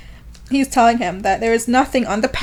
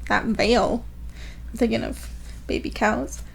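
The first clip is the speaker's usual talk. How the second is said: usual speaking voice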